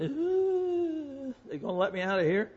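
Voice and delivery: high, quick, jittery mock worry; nervous prisoner. Preacher imitating his nervous thought as the prison door clanks, high and quick. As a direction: squeak it like a scared new inmate.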